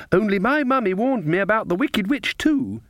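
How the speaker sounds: put-on high-pitched child voice; little girl. A narrator's reading. Girl tells the witches of mummy's warning, childlike. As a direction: report it as a small child.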